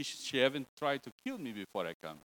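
ordinary delivery

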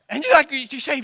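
ordinary delivery

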